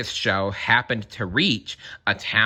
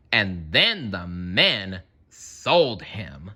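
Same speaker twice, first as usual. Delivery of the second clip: usual speaking voice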